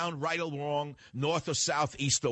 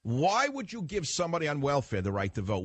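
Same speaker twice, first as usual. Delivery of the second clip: normal talking voice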